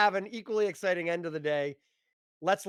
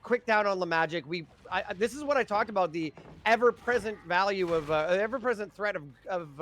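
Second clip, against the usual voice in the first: ordinary talk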